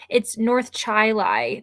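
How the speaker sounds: Chili said CHY-lye, slow and drawn; slow local CHY-lye; local pronunciation. Shown as a demonstration. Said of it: "this is a funny thing".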